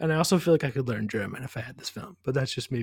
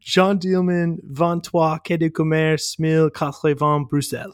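louder, careful French title read with a French accent; rudimentary French; 3 semitones higher, 6 dB louder than usual. Shown as a demonstration. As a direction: proudly recite the French title. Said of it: "my rudimentary French".

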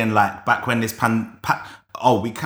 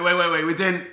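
ordinary talk, nothing marked

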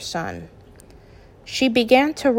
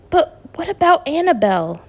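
higher pleading question; despairing; 8 semitones higher than usual. A narrator's reading. Son worried about his girlfriend, in despair. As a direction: plead as if all is lost. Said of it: "with utter despair in his tone".

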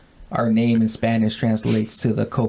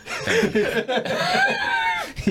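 high whooping laugh; big shouted laugh. Real, not acted. Laughing at the intro.

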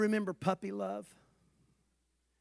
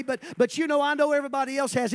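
quick mock excuse tumbling out; rushed excuse; 6 semitones higher, 10 dB louder, faster than usual. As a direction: hurry through the excuse dismissively. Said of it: "I'm not even gonna say".

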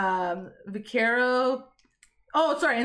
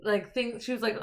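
usual speaking voice

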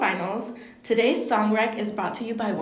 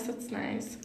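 whispered; 11 dB quieter than usual. Real, not acted. Repeats compliment, second time whispered.

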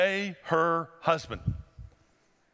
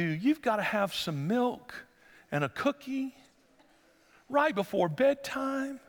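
mock sweetness; 6 semitones higher than usual. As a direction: lay on the sweetness ironically. Mock-tender bedtime talk.

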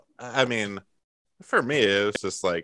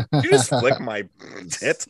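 normal talking voice

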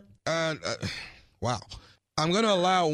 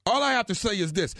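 ordinary talk

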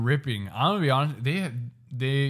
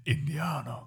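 Russell Peters; 5 dB quieter than usual. Retold or imitated. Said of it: "Oh, Russell Peters".

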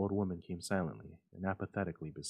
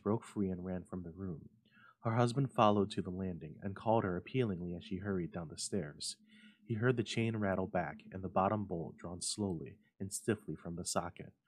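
usual speaking voice

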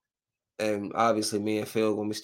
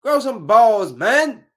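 much higher, louder and slower, "grow" punched; exasperated outburst; 11 semitones higher, 9 dB louder, slower than usual. Real, not acted. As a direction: scold the wimp on screen. Ranting at TV characters.

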